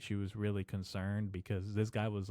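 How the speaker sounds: ordinary talk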